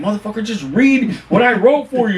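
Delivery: high-pitched exasperated retort; arguing back. Retold or imitated. Director to narrator who won't read, acted exasperation. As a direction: imitate yourself snapping in a studio argument. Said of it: "we're going back and forth".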